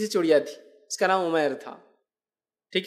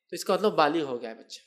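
usual speaking voice

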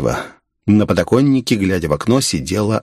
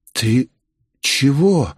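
hesitant, rising higher on the second word; frightened; 4 semitones higher, much slower than usual. A narrator's reading. Girl facing his maniacal grin, frightened. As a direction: ask uneasily, with a touch of fear. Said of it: "испуганно спросила девушка".

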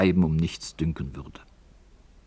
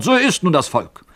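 put-on higher and louder, an old man flaring up in anger; angry old miner; 4 semitones higher, 4 dB louder than usual. Old miner scolding young Elis, angry and loud. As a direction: snap it out, offended. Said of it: "rief der Alte erzürnt".

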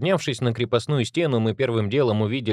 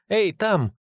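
calling out; 10 semitones higher than usual. Acted. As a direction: call out to get his attention. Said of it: "окликнула она".

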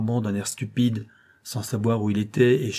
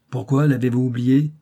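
gruff; 3 semitones higher, 6 dB louder than usual. A narrator's reading. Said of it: "dit-il d'un ton bourru".

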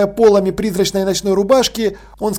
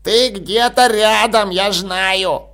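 put-on old man voice; much slower than usual. A narrator's reading. Dead old man speaks.

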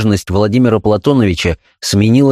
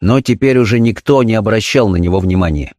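plain reading voice

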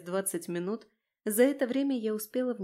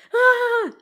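loud, high sustained scream; piercing drawn-out shriek; 11 dB louder than usual. A narrator's reading. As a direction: scream to scare off a beast. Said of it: "заверещала".

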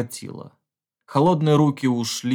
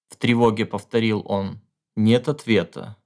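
ordinary delivery, nothing marked

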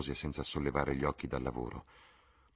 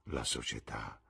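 softer, lower scornful murmur; 6 semitones lower, 8 dB quieter than usual. A narrator's reading. Jim scorning London society, low murmur. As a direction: mutter it with contempt. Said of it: "mormorò il ragazzo".

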